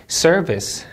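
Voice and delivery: 'service' with the weight on SER; first-syllable stress. Shown as a demonstration. Teacher drilling word stress, first syllable strong. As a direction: punch SER, relax on -vice. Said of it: "이름절 강세".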